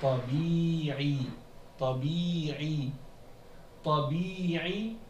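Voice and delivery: Tabi'i with both i sounds held long, "tabii-ii"; two long vowels. Shown as a demonstration. Teacher reads the word out, Tabi'i with two long i vowels. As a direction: stretch each i in Tabi'i. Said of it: "il y a deux longues voyelles".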